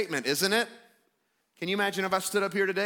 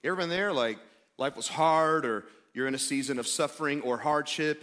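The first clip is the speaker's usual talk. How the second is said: normal talking voice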